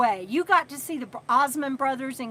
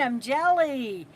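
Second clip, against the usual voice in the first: ordinary talk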